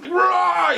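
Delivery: high-pitched imitated roar; mock scream. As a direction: throw out a short high film-monster scream. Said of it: "he's screaming, it's really loud".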